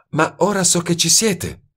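high, knowing and sly. A narrator's reading. Woman tells detective she knows, sly. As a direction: tease him, she's caught him out. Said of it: "disse in tono astuto".

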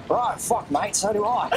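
put-on Gandalf with a light Australian accent. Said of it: "Australian Gandalf".

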